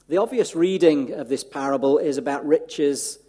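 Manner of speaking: emphatic preacher, pressing each point; preaching. Acted. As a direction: drive the message home to the congregation. Preaches the sermon at full force.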